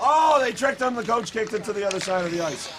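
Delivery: high burst of surprise; surprised play-by-play. Real, not acted. Announcer reacting as the coach tricks the player, surprised. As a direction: jump in high, then tell it quickly.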